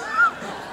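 laugh. Real, not acted. Laughter continues.

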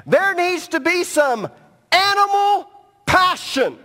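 loud and high, building to a shout; preaching shout. Acted. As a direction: hammer the point home to the crowd. Preacher voices Solomon's advice.

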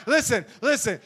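normal talking voice

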